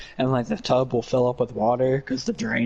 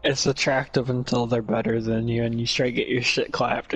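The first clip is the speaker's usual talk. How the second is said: ordinary talk, nothing marked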